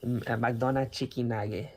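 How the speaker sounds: put-on "McDonald's chicken nugget" in a Mexican Spanish accent, "makdonat chikin agget"; Mexican mom accent. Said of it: "love pronouncing ... as".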